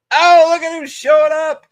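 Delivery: loud, high happy call-out; excited greeting. Real, not acted. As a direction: shout a welcome to a buddy arriving. Greeting a friend joining the stream.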